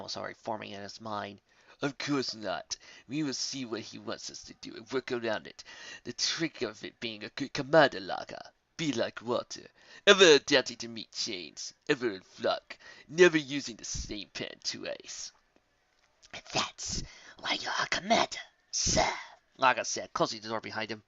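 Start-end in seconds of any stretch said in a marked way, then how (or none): 1.8-15.3 a hint of a calm, cunning leader; sly commander. Commander character voice. 16.5-19.1 higher hushed whisper. Acted. Laga whispering praise to his commander. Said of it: "whispered reply to commander".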